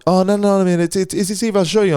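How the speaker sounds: high, insistent imitation of a Kiwi; Kiwi accent. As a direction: put on a Kiwi accent, pitched well up. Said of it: "that's my fucking Kiwi accent".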